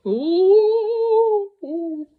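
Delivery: high rising ooh as he tumbles; the bear's cry while tumbling off the bed; falling ooh. A narrator's reading. Bear toppling.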